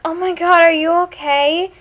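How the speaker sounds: high-pitched, gushing worry; mock concern. Friends seeing her eyes water, mock concern.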